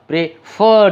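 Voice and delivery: Prefer with a long, weighted FER; stress on last syllable. Shown as a demonstration. Teacher recalling the stressed example, prefer stressed on FER. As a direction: push the second syllable, pre-FER. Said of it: "যেমন বলেছিলাম".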